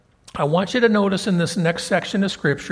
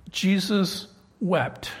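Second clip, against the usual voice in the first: normal talking voice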